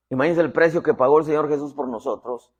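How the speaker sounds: normal talking voice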